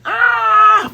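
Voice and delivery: sudden high, loud cartoon yelp; mock scream. Buggy getting kicked, high loud yelp. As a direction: yelp like you just got kicked.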